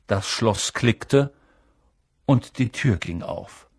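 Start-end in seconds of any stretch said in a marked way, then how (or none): none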